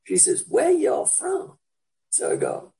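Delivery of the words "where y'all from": slow, higher Alabama drawl; slow mock Alabama accent. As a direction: imitate a local Alabama shop lady, drawn out. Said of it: "an Alabama accent".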